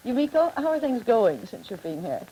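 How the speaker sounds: usual speaking voice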